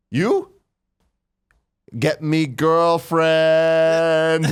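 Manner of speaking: high, slow, mock foreign voice; goofy high accented plea; stoned guy's broken English. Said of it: "And he goes".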